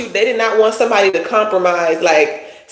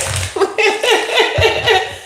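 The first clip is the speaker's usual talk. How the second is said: high laugh; 12 semitones higher than usual. Real, not acted. Laughs at own joke.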